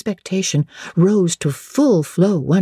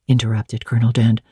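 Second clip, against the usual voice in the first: ordinary delivery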